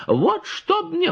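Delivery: very high, slow oath; swearing peasant woman. A narrator's reading. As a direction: protest with an oath.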